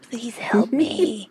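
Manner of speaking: slow, eerie pleading ghost voice; mock spooky. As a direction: plead like a haunting spirit. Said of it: "You sound spooky as hell".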